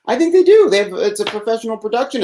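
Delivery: very high, loud mock squeal. Mocking big YouTubers, a high silly voice.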